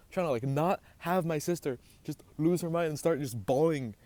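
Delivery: ordinary talk, nothing marked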